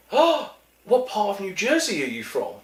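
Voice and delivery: high-pitched mock surprise from a girl; supermarket girl. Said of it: "this girl stopped and looked at me and went".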